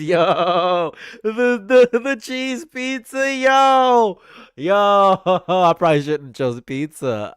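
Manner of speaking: loud laughing, high stretched words; cracking up. Real, not acted. Streamer realises the pizza implications, loud helpless laughing outburst. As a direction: burst out laughing through the words.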